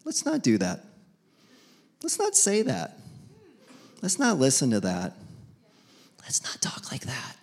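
soft, fading to a whisper; Spirit's soft warning trailing to a whisper; gentle caution. Retold or imitated. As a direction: hush them gently.

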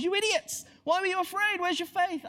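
exasperated telling-off; mock rebuke. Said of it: "We sometimes hear that as a rebuke".

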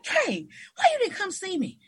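high-pitched retold complaint; mock confrontation. Teacher phoning absent student, high confronting tone.

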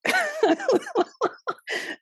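high giggle. Real, not acted. Giggling at son's game.

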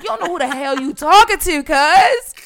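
loud, high, heated warning; confrontational. Retold or imitated. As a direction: square up to them verbally. Retold threat in the quarrel.

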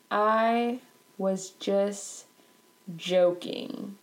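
very slow, word-by-word mockery; mocking repetition. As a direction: drag out each word of the hollow excuse. Mockingly repeats Chad's excuse slowly.

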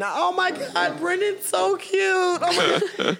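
very high, squealing fangirl mimicry; mock a swooning TV viewer, high and drawn out; gushing female fan. Man mimics women watching The Bachelor, high gushing mock voice.

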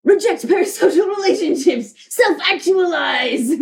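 mock yell. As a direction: proclaim it like a rallying broadcast.

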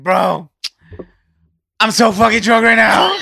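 put-on loud, high, laughing drunk bro. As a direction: slur it like a wasted friend. Drunk friend in back seat voiced.

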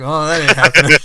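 loud, laughing through the quote; laughing retold quote. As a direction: crack up while imitating his refusal. Laughing while quoting husband's reply.

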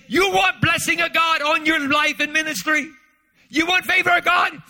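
put-on high, loud Korean-accented preaching shout; mock Korean accent. Korean pastor challenging conference pastors, a loud mock Korean accent. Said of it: "I don't have a good Ch-, uh, Korean accent".

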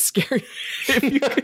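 laughing through the words; chuckling while talking. Real, not acted. Laughing at the invisible man joke.

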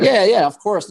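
high, loud eager yes; eager reply. Retold or imitated. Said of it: "So I said".

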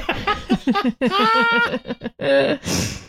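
long high laugh; laughter. Real, not acted. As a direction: burst out laughing after clowning. Laughing after the shout.

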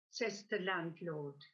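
normal talking voice

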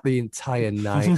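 ordinary talk, nothing marked

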